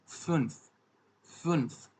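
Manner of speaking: फुन्फ with a rounded ü vowel; German fünf. Shown as a demonstration. Teacher showing learners five, फुन्फ with rounded ü. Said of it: "इसको हम बोलते हैं".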